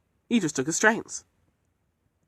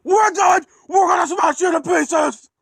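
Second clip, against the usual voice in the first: high, loud, crying shout of revenge; threatening shout; 6 dB louder than usual. Acted. Trucks screaming threats at Edward, loud threatening shout. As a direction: bellow the threat at him.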